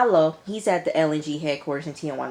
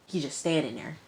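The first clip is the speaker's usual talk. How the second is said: normal talking voice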